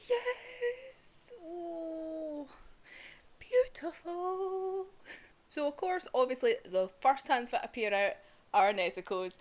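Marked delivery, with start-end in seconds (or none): 0.1-4.9 high, breathless, slow beautiful; awed delight. Real, not acted. Fan reliving the rescue, awestruck and delighted. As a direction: melt in awe.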